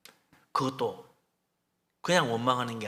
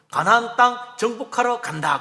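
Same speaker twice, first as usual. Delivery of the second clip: slow, louder, higher leader's announcement; mock proclamation; 6 semitones higher, 8 dB louder than usual. Preacher voicing Moses announcing conquest, slow loud proclamation. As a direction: proclaim the march like a commander. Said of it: "그러는 거지".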